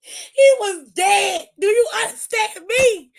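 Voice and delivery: slow, high, loud words through laughter; laughing shout. Real, not acted. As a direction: cry it out while cracking up. Laughing at the big splash.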